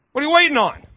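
normal talking voice

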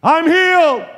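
high, very loud triumphant holler. Acted. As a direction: shout the news across the house. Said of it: "he hollered out to my mother".

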